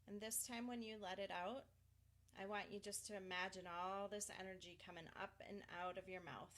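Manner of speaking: soft, unhurried meditation voice; guided breathing. Acted. Tapping coach guiding a client to release energy, soothing.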